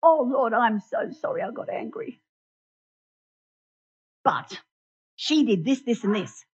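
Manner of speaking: a hammed-up sinner excusing himself to God; mock self-justifying prayer. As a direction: pray sorry, then slip in the excuse. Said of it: "We go to Jesus and we say".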